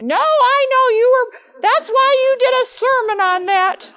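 very high, loud and slow, refusing to hear otherwise; high, loud, slow insistence that breaks into a laugh; insistent congregant. Retold or imitated. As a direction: wave off the pastor's denial with a laughing certainty. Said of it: "And he's like".

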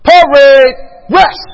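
slow, loud parade-ground order; yelled command. Retold or imitated. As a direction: yell it out to a whole battalion, stretching each word. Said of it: "he yells out to the whole multitude".

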